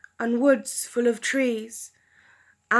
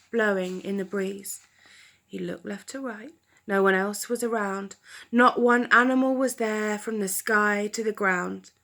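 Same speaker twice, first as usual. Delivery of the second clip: usual speaking voice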